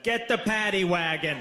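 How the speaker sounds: lightly voiced police officer radioing in; officer on walkie-talkie. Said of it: "leaned into his walkie-talkie and went".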